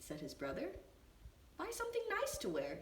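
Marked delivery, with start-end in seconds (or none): 1.6-2.8 put-on high, quick little-girl voice; sister's voice. A narrator's reading. Sister suggests a gift, high and quick. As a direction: chirp it up high like a young sister. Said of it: "said his sister".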